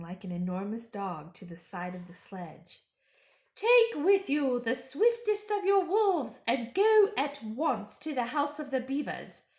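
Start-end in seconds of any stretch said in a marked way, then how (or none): 3.5-9.4 witch's order. Acted. Witch sending Fenris Ulf to kill, commanding. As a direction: order the wolf like a queen. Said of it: "said the witch".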